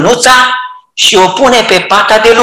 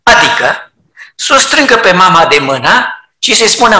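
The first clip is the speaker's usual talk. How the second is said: ordinary talk, nothing marked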